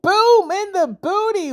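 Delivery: high, drawn-out gleeful cheer; triumphant, slow high-pitched call; gleeful exclamation. Real, not acted. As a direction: celebrate the hit, stretching the words high. His big move lands.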